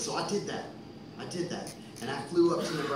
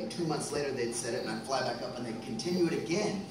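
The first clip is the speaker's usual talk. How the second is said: normal talking voice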